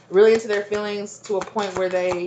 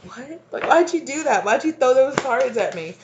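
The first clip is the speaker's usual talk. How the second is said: higher, puzzled dog voice, lightly hurt; dog's confused voice; 6 semitones higher than usual. Retold or imitated. Owner voices her startled dog asking why cards hit him, higher and puzzled. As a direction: play the dog asking why, bewildered not angry. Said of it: "but he was like".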